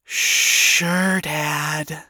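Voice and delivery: defeated, sighing daughter; resigned giving-in, fading away; exasperated sigh. A narrator's reading. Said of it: "Charlie sighed in exasperation and defeat".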